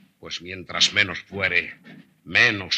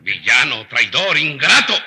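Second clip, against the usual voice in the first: each insult louder and sharper; furious insults; 2 semitones higher, 6 dB louder than usual. Acted. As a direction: spit out the insults in rising rage. Insulting the disloyal tutor. (insultos furiosos)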